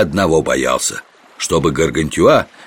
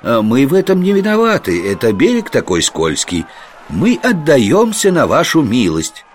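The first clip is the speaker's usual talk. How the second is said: higher-pitched mock pleading; притворная сдача; 8 semitones higher than usual. A narrator's reading. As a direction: plead innocently, pretending to surrender. Монах притворно сдаётся рыцарям. (притворно оправдываясь, высоким голосом)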